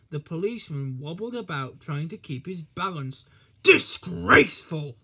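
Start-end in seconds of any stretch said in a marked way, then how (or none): 3.6-5.0 loud, slow, spluttered indignation; spluttering outrage. A narrator's reading. Cross policeman splutters at Thomas, spluttering outrage. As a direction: blurt it out in flustered outrage. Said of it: "he spluttered".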